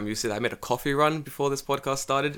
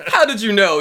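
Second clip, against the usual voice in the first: mock shocked question; 9 semitones higher, 11 dB louder than usual. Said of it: "And I'm like".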